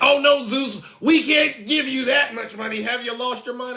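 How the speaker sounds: hammed-up panicked refusal; mock shocked supporter. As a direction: play the supporter recoiling in alarm.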